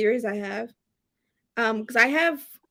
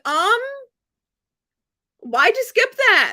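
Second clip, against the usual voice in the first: high, slow incredulous complaint; mock indignant question; 8 semitones higher, 4 dB louder than usual. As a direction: act baffled at the skipped paragraph.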